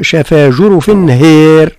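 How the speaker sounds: هير with the vowel leaned to e; imala. Shown as a demonstration. Teacher showing the imala of هار. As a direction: tilt the vowel of هير toward e. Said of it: "هكذا".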